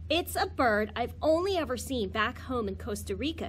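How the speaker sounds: ordinary delivery, nothing marked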